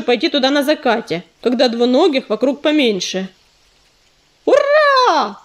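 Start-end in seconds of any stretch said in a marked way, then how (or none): 4.4-5.4 high triumphant cheer; joyful whoop. A narrator's reading. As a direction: shout it with glee. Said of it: "завопил Белохвост".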